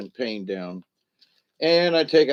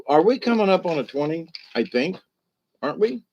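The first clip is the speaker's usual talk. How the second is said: ordinary talk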